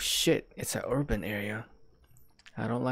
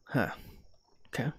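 normal talking voice

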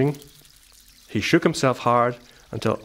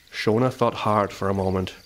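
ordinary delivery

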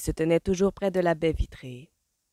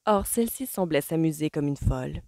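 usual speaking voice

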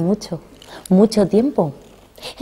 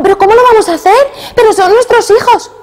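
high, pleading cry of a mother in shock; a frightened mother's protest; horrified mother; 14 semitones higher, 4 dB louder than usual. Acted. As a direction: beg him, aghast. Mother horrified at abandoning children.

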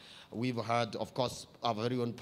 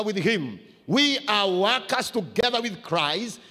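loud, high, slow preaching; full-force preaching; 8 semitones higher, 8 dB louder than usual. Acted. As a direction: preach it to the church with fire.

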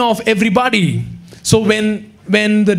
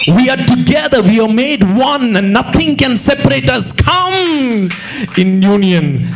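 full-force preaching; 3 semitones higher than usual. Acted.

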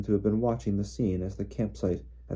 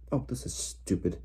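softer, higher fretful aside; exasperated aside; 4 semitones higher, 5 dB quieter than usual. A narrator's reading. Hermione unable to see in the dark, exasperated. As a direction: fret to yourself in frustration.

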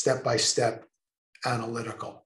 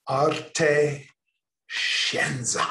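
Arte scienza in a hammy Italian accent, open vowels; 4 dB louder, much slower than usual. Shown as a demonstration. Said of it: "Say it in your best Italian accent".

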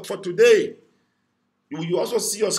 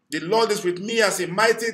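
usual speaking voice

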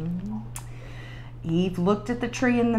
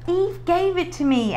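blurted out; 10 semitones higher than usual. A narrator's reading. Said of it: "Adam blurted out".

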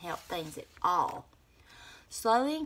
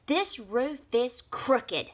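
snapping; 6 semitones higher, 4 dB louder, slower than usual. A narrator's reading. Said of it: "Snap Bean snapped".

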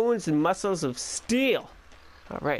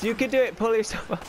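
higher-pitched cheering on; playful encouragement; 7 semitones higher than usual. Pretend. Player urges Drake to pull up, playful high-pitched encouragement. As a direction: coax a climber up, half joking. Urging character to climb.

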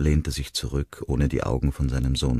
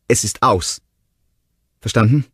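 louder, higher, final; angry breakup; 8 semitones higher, 7 dB louder than usual. A narrator's reading. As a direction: slam the door on it. Ronnie rompe con él.

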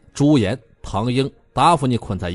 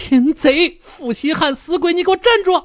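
furious chase shout. A narrator's reading. Fairy Mengluo chasing Bai Jianfei, high furious shouting. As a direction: shout after a fleeing man. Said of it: "梦罗仙子怒气冲冲".

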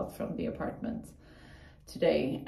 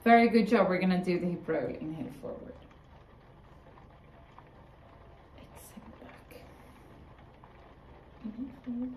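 calm class instructions; yoga instructor; 9 dB quieter than usual. Acted. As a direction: coach the breathing. Leads yoga exercises.

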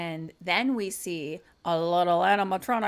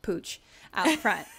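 ordinary delivery

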